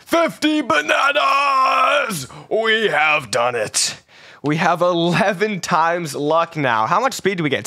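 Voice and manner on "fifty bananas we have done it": slow, drawn-out victory yell; high slow shout of triumph; triumphant shout. Real, not acted. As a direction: bellow it like a trophy. Triumphant announcement.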